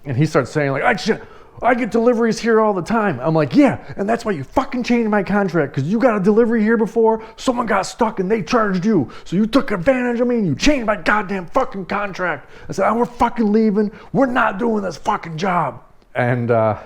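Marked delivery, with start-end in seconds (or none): 0.8-3.2 high-pitched, indignant protest; raised-pitch defensive yell; imitated yelling back. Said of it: "He starts yelling at me too". 3.6-12.5 high, heated accusation; worked-up cursing rant pitched high; re-enacted angry yelling. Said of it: "continued to yell at him". 12.9-15.8 high, furious announcement of quitting; heated, final refusal; re-enacted angry yelling. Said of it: "continued to yell at him".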